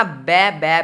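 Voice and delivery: बै said short and soft, no final t; pronunciation demo. Shown as a demonstration. Teacher drilling students, soft clipped बै. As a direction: repeat बै softly as a clean syllable. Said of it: "बै पढ़ते हो ना".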